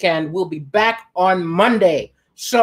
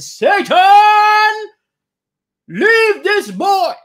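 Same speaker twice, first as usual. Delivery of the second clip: high, loud, slow exorcist command; hammed-up priest casting out; 12 semitones higher, 6 dB louder, much slower than usual. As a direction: order the demon out, slowly and loud.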